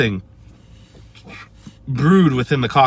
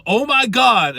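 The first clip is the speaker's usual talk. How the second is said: mock scream; 11 semitones higher, slower than usual. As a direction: shout it like a fan losing it in the bathroom.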